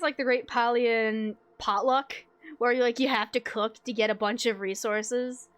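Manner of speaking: normal talking voice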